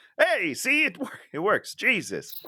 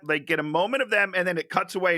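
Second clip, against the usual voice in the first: usual speaking voice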